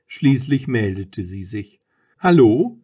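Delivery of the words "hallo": high, loud questioning hello; clear, raised phone greeting; free, not hoarse. A narrator's reading. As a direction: answer the phone clearly, lifting at the end. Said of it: "Sie klang frei, nicht so heiser".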